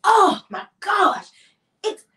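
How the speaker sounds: high, loud mock wail of disgust; hammed-up imitation of an overdramatic husband; dramatic disgust. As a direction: cry out as if appalled by a stink. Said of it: "this is how he would say it".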